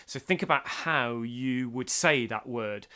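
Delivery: ordinary delivery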